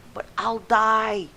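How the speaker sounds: high, slow, loud plea; pleading. Acted. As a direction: whine in fear. Recruit protests.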